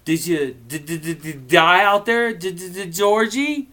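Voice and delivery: stuttering Bill calling. Acted. Bill stuttering as he calls to Georgie, mock stammer. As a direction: stammer through it, calling from the bedroom. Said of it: "Bill called".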